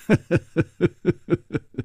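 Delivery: a short, bitter chuckle; a lightly voiced rueful laugh; wry laugh. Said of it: "楚留香苦笑着说".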